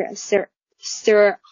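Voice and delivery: sir 读成卷舌的美式音，放慢; American r sound. Shown as a demonstration. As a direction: stretch sir with a strong American r. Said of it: "那它的美式发音呢，就是". (美式卷舌 sir)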